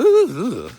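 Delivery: high, loud, thrilled double ooh; quivering delighted ooh ooh; ecstatic ooh. Shown as a demonstration. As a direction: let the energy jolt out as an ooh. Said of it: "you're gonna".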